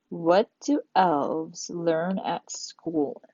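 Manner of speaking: slow, drawn-out riddle question; reading a riddle slowly to the class. Shown as a demonstration. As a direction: read the riddle slowly and teasingly. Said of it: "reading riddle to class".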